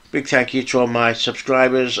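ordinary talk

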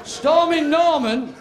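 a mock-grand slow intro; drawn-out announcement. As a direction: stretch the name out like a ringmaster. Comic intro of a character.

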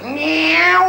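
high drawn-out mock meow; cartoonish cat cry. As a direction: miaow like a cat on a wall. Cat noise in joke.

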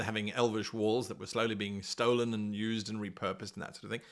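normal talking voice

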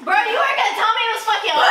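loud, fast, laughing outburst; startled yell. Real, not acted. Burned by the open oven.

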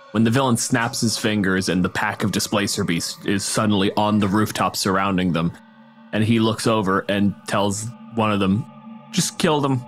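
ordinary talk

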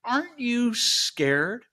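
slow, high, drawn-out challenge; menacing warlock's question. Acted. Warlock testing the innocent girl in the woods, slow menace. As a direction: test the child, stretching each word. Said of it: "right before he freezes her to death, he says".

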